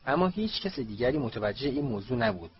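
ordinary delivery

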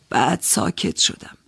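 ordinary talk, nothing marked

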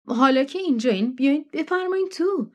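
high, eager hostess voice pressing them in; a pushy country hostess insisting they come in; June's insistent welcome. Acted. Hostess June pressing the guests to come inside, high and eager.

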